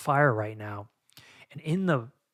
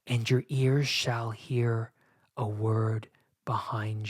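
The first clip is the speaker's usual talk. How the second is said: scripture reading; 3 semitones lower than usual. Acted. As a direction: read the verses reverently, drifting into a whisper. Reads Bible verses aloud.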